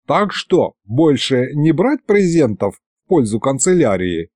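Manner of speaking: usual speaking voice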